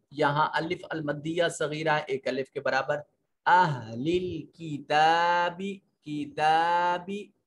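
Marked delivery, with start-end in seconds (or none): 3.5-7.3 किताबी said with the ता stretched long; lengthened ta. Shown as a demonstration. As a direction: draw out the ता in किताबी, slowly. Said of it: "ता को लंबा करके पढ़ेंगे". (किताबी में ता को लंबा खींचकर)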